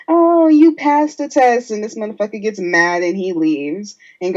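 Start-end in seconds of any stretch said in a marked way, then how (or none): 0.1-1.7 higher, slow, smug girly imitation; sing-song mock voice. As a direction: lightly play a pleased girlfriend. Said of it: "and she's like".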